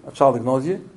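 normal talking voice

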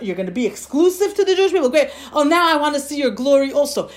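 high, loud, eager asking; Moshe Rabbeinu. Acted. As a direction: rush on greedily to the next request. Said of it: "He keeps on asking more and more".

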